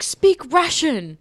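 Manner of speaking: high, loud, drawn-out plea; eager pupils. Retold or imitated. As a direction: imitate excited kids egging the teacher on. Pupils demand she speak Russian.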